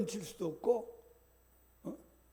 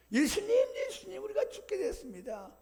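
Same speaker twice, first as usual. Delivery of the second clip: frightened cry; 12 semitones higher than usual. Acted. Disciples wake Jesus in storm.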